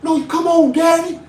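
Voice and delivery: high, loud protesting sons, shouting back; pleading sons. Retold or imitated. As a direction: play the sons pleading with daddy. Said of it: "her brothers were like".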